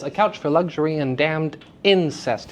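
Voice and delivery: natural stress, "incest" accented on its first syllable. Shown as a demonstration. Teacher trying moved stress, "incest" stressed at the start. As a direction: hit the "in" of "incest" after "damned". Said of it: "notice how it feels to say damned into in".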